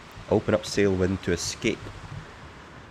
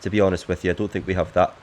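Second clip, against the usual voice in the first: ordinary talk, nothing marked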